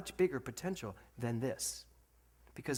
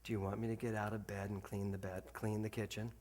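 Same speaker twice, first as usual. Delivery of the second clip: sulky daughter; 6 semitones lower, 9 dB quieter than usual. Retold or imitated. Daughter to dad from bed, softer and sulky. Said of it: "She looks at me, she goes".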